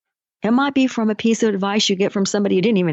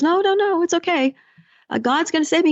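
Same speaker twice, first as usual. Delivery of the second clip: much higher, breezy no-no-no brush-off; stubborn refusal; 8 semitones higher than usual. Retold or imitated. Coach voicing the man turning down the motorboat, high and breezy. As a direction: wave the rescue away, oddly cheerful.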